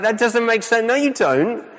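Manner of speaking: high-pitched imitation of a baffled kid; protesting child. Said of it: "She's like".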